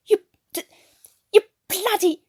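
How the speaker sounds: sputtering high, loud rage; a cockney losing his temper at the bully; furious outburst. A narrator's reading. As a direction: blurt it out, choking on fury. Newkirk furious over the photo.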